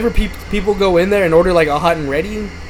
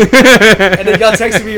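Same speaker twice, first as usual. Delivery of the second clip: laughing; 5 semitones higher, 8 dB louder, much slower than usual. Real, not acted. Laughing recalling the prank.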